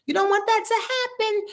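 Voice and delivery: high teasing warning; high mock warning. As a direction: wag a finger in a high silly voice. Repeated warning in put-on voice.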